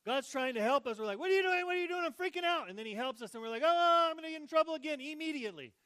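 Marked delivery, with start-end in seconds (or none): 1.2-2.7 high, loud frantic squawk; mock panic. Sheep-like us panicking at God helping, high loud frantic. 3.6-5.0 high, loud, fast fretful whine; mock worry. Rescued sheep fretting it will be in trouble, high fast whine.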